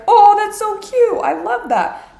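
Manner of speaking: high, loud, drawn-out cooing; mock gushing. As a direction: imitate people fawning over a cute pet. Acts out everyone gushing over cat.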